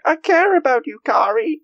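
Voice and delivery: high squeaky shout of caring; hammed-up high-pitched heartfelt cry; squeaky mock shout. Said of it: "he's just like".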